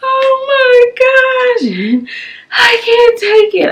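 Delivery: high, slow, drawn-out crying wail; reliving the moment she fell apart; broken-down wail. Retold or imitated. Breaking down to her husband on FaceTime, high crying wail. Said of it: "broke down".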